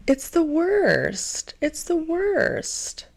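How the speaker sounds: high, drawn-out whine; whiny, long-drawn complaint; exasperated lament. Real, not acted. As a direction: stretch it out slowly in high-pitched frustration. Exasperated lament about breakups.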